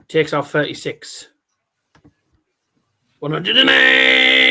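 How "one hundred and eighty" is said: loud, drawn-out one-eighty call; darts score caller. Retold or imitated. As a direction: stretch it like a darts announcer.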